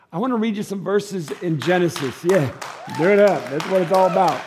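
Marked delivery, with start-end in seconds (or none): 2.2-4.4 excited shout. Real, not acted. Calls out in response.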